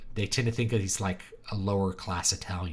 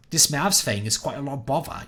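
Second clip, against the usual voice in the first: higher, louder mock Cockney accent; Cockney sailor; 5 semitones higher, 3 dB louder than usual. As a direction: play an East London Cockney sailor. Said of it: "like, a Cockney sailor".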